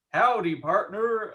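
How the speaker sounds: high, loud, drawn-out American cowboy greeting; badly faked American accent, slow and loud. Shown as a demonstration. Actor attempting an American accent on request, badly faked. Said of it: "very bad American accent".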